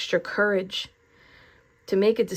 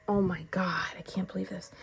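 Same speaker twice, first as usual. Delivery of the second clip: choked up; 6 dB quieter than usual. Real, not acted. Tarot reader moved by her message on bravery, choked-up whisper. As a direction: voice dropping to a whisper. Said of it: "I'm gonna get choked up again".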